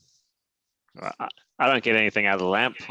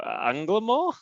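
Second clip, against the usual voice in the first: questioning; 11 semitones higher, slower than usual. Acted. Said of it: "raise one eyebrow and go".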